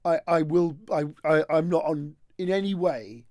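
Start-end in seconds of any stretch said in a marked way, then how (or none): none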